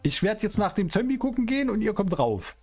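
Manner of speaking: normal talking voice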